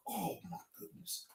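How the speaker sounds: breathless whispered disbelief; stunned whisper. Real, not acted. Stunned by the statement, whispering in disbelief. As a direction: barely breathe the words out.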